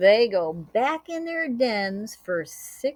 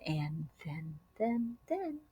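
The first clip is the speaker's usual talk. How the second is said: whisper; 5 semitones lower, 10 dB quieter than usual. A narrator's reading. Suspense before the shout.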